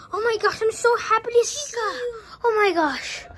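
high-pitched, thrilled welcome; overjoyed greeting. Acted. Character thrilled to see a visitor, overjoyed. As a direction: gush with delight at seeing them.

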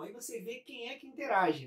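ordinary delivery

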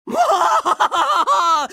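hammed-up triumphant cackle; long gloating villain laugh; evil villain laugh. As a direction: cackle like a cartoon bad guy.

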